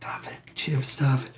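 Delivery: hushed, urgent whisper telling him to stop; whispered scolding. Real, not acted. Streamer whispering to her cat Jim to stop yawning.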